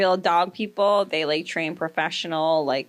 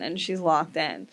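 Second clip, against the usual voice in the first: normal talking voice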